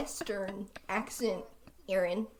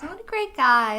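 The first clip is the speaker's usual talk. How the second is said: sarcastic; 5 semitones higher, 7 dB louder than usual. Real, not acted. Sarcastic remark about villain.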